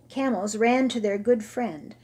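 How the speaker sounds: plain reading voice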